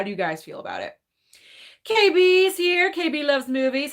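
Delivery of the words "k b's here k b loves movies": excited greeting. Real, not acted. Host greets a viewer joining the chat, excited. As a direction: hail an arriving friend with stretched words.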